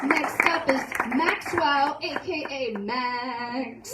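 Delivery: slow, drawn-out emcee introduction; announcer. Real, not acted. Host calling the next student up, announcer style. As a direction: stretch the name out like an announcer.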